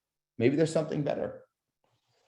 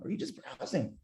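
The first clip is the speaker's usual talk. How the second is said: softer, lowered curious question; tonality down; 4 semitones higher, 10 dB quieter than usual. Shown as a demonstration. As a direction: drop it down, softly curious. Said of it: "bring that tonality down".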